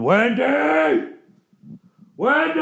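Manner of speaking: rising calls of Wendy, a lightly voiced madman; Jack Nicholson impression. Office worker limping with axe at Halloween, calling Wendy. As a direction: call Wendy like a stalking axe man. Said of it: "dress up like Jack Nicholson".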